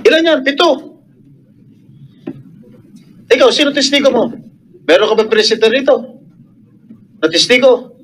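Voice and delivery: high, challenging interrogation. Real, not acted. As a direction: grill the witness with indignation. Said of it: "paano hindi tataas ang boses namin". (galit na pag-uusisa, mataas ang boses)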